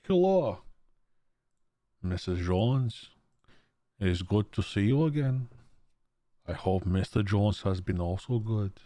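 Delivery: voice changer. Shown as a demonstration. Streamer trying his voice changer on chat, altered voice. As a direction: greet Missus Jones through a voice changer. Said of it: "wait, what is my voice changer?".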